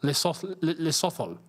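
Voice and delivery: "Lesotho" as lih-SOTH-oh, restarting; spelling pronunciation. Shown as a demonstration. Streamer testing the spelling, halting "Lesotho" with TH. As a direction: stumble into "Lesotho" with a TH. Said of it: "saying it as it's spelled".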